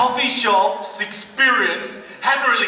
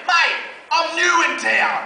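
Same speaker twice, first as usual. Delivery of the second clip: chirpy youth worker; 8 semitones higher, slower than usual. Retold or imitated. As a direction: shout it out, friendly and keen.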